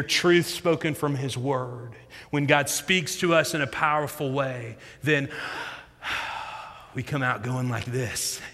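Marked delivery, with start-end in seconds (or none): 6.0-6.7 long, breathy exhale of relief; acted sigh of relief; relieved sigh. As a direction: let the breath out as if unburdened. Acts out relief after God speaks.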